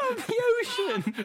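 high, squeaky laughing question. Real, not acted. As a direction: laugh it out in disbelief. Cracking up in disbelief.

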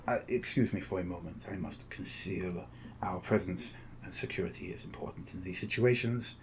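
softly spoken formal host, measured and discreet; courteous, secretive agent. Acted. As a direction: speak softly as a polite go-between. Game master voices Face Dancer.

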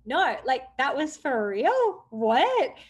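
loud, slow, high disbelief; disbelieving. Retold or imitated. To the photographer, loud slow disbelief. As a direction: ask it like you can't take it in. Learns it was true.

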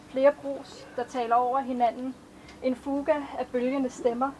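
measured, even poetry recitation; a poet reading her own verses to an audience; poem reading. Acted. Author reads her poems aloud.

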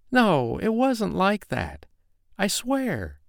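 high, tearful confession; a scared girl begging to be believed; frightened young woman pleading. Acted. Mariah confesses her fear to angry KC, high and pleading.

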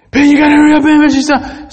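very high, loud panicked call; panicked mother. Retold or imitated. Mother calling Ben in panic, very high and loud. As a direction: cry out for help in alarm.

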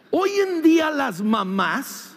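ordinary talk, nothing marked

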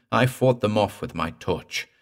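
ordinary delivery

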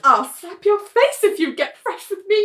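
high put-on flirty scolding; [name], playful sleep-talk. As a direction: imitate a dreamer teasing a sweetheart. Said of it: "[name] laughs in her sleep".

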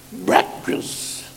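ordinary talk

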